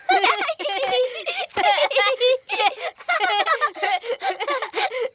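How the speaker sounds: long rire aigu et joyeux. Acted. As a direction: rire de bon cœur, qui monte. Enfant rit en galopant. (long éclat de rire d'enfant)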